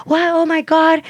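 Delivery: high gasping disbelief; mock-shocked reaction; shocked bystanders.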